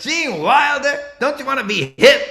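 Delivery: high, loud, fast scornful yelling; mock outrage. Veteran comic mocking an old-school impression, loud scorn. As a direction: scold like a hip veteran. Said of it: "He goes".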